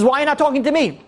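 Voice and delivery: high-pitched indignant demand, retold; hurt outburst. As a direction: act out an ignored son confronting his father. Acted outburst of ignored son.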